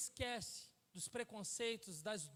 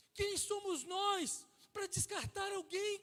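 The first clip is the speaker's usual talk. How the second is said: loud, higher, slow preaching cry; preaching climax; 8 semitones higher, 5 dB louder, much slower than usual. Real, not acted. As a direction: drive the question home to the church. Preacher repeats at full force.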